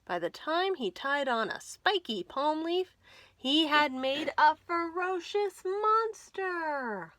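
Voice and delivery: usual speaking voice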